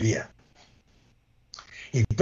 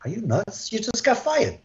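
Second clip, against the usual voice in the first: high, wide-eyed disbelief; stunned colleague; 8 semitones higher than usual. Retold or imitated. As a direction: imitate a shocked colleague. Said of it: "his eyes are like wide".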